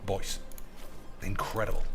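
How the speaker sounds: catchphrase fading out; fading echo. Pretend. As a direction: let it die away to nothing.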